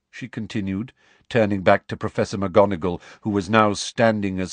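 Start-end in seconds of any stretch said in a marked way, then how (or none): none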